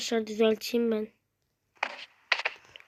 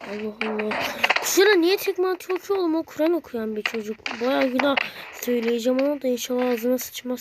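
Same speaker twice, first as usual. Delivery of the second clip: ordinary talk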